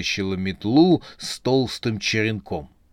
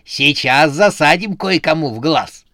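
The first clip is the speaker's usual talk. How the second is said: loud, higher combative threat; threatening; 5 semitones higher, 6 dB louder than usual. A narrator's reading. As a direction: threaten a punch, spoiling for a fight. Witch threatens while grabbing clown.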